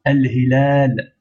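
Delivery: Al hilal slowly, the final a held long; prolongation. Shown as a demonstration. Teacher repeating to show prolongation, hilal stretched. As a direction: draw out the a in hilal.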